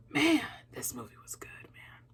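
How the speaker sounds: soft whispered awe. Real, not acted. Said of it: "I feel like a proud sister".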